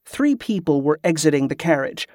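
usual speaking voice

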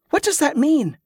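high-pitched, urgent questioning; anxious question. A narrator's reading.

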